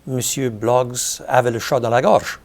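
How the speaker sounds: "Monsieur Bloggs avait le chat dans la gorge" in native French pronunciation; spoken French. Shown as a demonstration. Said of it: "you translate it".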